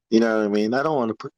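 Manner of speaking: normal talking voice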